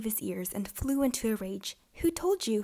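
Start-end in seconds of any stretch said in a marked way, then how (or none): 2.0-2.6 high shrieking little man. A narrator's reading. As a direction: shriek it in a rage. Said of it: "he shrieked".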